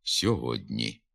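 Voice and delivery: «сьогодні» slow with soft дь; softened д. Shown as a demonstration. Teacher repeats the softened pronunciation. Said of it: "тому сам м'якшиться".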